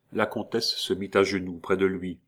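ordinary delivery, nothing marked

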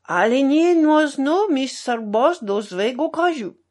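loud, high protest in Polish; indignant. A narrator's reading. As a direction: protest with wounded national pride. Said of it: "surtout était indigné".

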